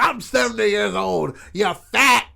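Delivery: high-pitched imitation of an irate old man; angry old man.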